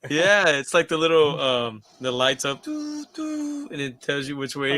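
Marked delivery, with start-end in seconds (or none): 2.6-3.7 soft, high "doo" gadget tones; imitated beeping. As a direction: imitate a little gadget's lights, high and quiet.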